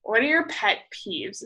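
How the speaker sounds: ordinary talk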